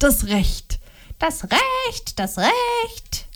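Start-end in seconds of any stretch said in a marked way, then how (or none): 1.2-3.2 much higher, softer scornful echo; indignant mockery. A narrator's reading. Wife mocking her husband's claim, indignant. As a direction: throw his word back at him mockingly. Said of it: "erwiderte Liliana aufgebracht".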